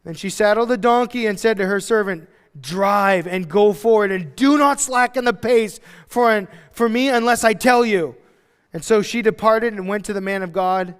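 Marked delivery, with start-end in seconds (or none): 2.6-8.2 loud, raised, drawn-out order; declaimed order, stretched and loud; urgent command. Acted. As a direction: command the servant forcefully. Acts woman commanding servant to hurry.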